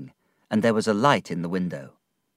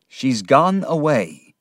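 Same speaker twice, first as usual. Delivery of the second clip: slow, louder, anguished statement; distressed reply; 5 semitones higher, 6 dB louder, slower than usual. A narrator's reading. Ham tells the narrator Emily has left, in distress. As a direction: force the bad news out slowly. Ham breaks the bad news.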